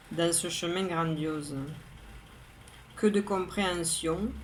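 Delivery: measured poem reading, a pause after each line; poem recitation. Acted. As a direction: recite line by line, evenly and composedly. Reads own poem aloud.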